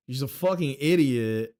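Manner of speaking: normal talking voice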